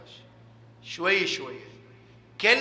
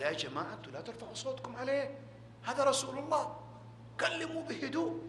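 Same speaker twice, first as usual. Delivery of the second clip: hushed gentle command; 4 semitones higher, 10 dB quieter, faster than usual. Acted. God quoted telling to speak calmly.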